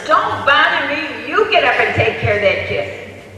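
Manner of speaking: loud, irritated acted refusal; yelling father. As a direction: bark it back like an annoyed husband. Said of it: "father yelling".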